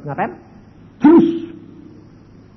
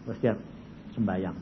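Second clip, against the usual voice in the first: ordinary talk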